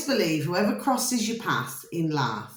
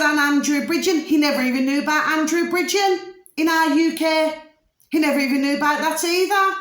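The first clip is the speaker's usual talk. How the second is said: high, louder incredulous outburst; worked-up retold rant; 9 semitones higher, 5 dB louder than usual. Passenger retelling an argument with a taxi driver, worked-up and incredulous. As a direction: retell it raised and exasperated, pitched well up.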